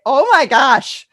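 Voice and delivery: loud, high gushing exclamation; hammed-up thrilled outburst; acted excitement. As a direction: show off over-the-top enthusiasm. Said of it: "You've gotta build some enthusiasm".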